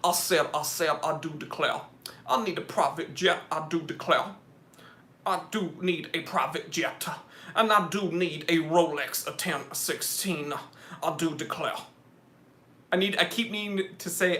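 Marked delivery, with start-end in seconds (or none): 0.0-11.7 mock Southern gentleman declaring himself; Southern bluster at an easy volume; Foghorn Leghorn. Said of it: "like, a foghorn leghorn".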